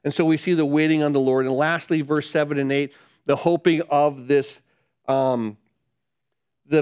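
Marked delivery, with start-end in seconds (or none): none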